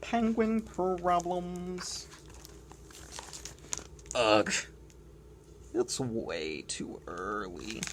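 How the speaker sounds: grumpy whiny penguin, slow and sulky; complaining penguin. Acted. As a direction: moan like a fed-up little penguin. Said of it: "Doing my complaining voice hurts".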